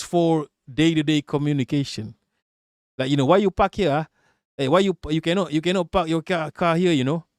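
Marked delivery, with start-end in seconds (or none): 3.3-7.2 day-to-day Malaysian English accent. Shown as a demonstration. Telling a driver they cannot park here, Malaysian English accent. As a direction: scold lightly in Malaysian English. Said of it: "that's how we speak, uh, English in Malaysia".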